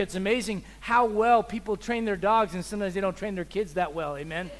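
normal talking voice

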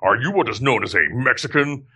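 stiff, formal alien officer impression; Lieutenant Worf. Prankster at fast-food drive-thru, solemn sci-fi officer impression. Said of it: "order Taco Bell as Lieutenant Worf".

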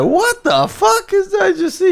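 high, shocked laughing yell; shocked outburst. Retold or imitated. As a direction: blurt it out in disbelief. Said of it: "be like".

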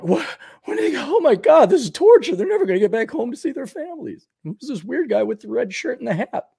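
put-on high-pitched kid's bewilderment; mock childlike distress. Child watching Gilligan's Island, high mock distress. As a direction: pitch way up, fretting like a confused child. Acts his uncomprehending childhood reaction.

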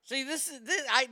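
usual speaking voice

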